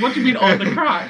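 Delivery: high-pitched mock disbelief; baffled non-Irish listener. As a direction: squeal it like a scandalised outsider. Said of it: "Anyone who's not Irish is gonna be like".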